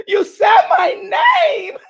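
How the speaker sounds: joyful shout dissolving into laughs; laughing mock shout. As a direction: laugh through it like overjoyed. Repeating it, now laughing.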